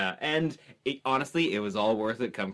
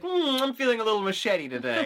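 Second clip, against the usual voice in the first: playful customer voice; 7 semitones higher than usual. Retold or imitated.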